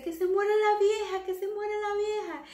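high parrot voice chanting; squawking parrot. Acted. The parrot taunting the passing old lady, high squawk. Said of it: "el loro dice".

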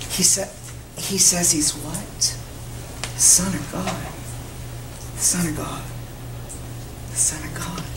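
low, hushed disbelief; stunned dread. Acted. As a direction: let it sink in, softly and haltingly.

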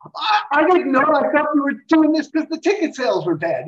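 backpedal, caught out and embarrassed; sheepish excuse. Retold or imitated. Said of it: "He's like".

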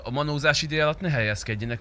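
ordinary talk, nothing marked

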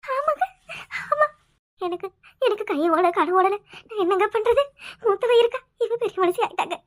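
halting tearful confession; acted weeping, words breaking up; sobbing. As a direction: choke the news out between sobs. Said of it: "அப்படி குலுக்கி குலுக்கி அழுவுற".

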